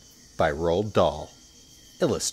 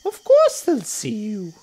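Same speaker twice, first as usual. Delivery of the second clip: snorting little crocodile; 10 dB louder than usual. Acted. Not-so-big crocodile deflating a boast, high scornful voice. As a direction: scoff in a tiny high voice.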